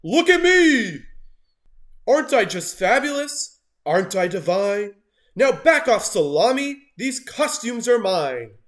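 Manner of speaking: high, loud diva shout; vain yell. A narrator's reading. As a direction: shove past and demand the spotlight.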